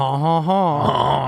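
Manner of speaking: slow, drawn-out theatrical ha ha ha; a hammed-up mocking laugh; mock villain laugh. Mocking the French, Airbus joke.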